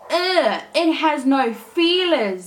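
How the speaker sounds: mayfly brother recoiling in disgust. Acted. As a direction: sneer at the wingless girl. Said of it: "said the brother".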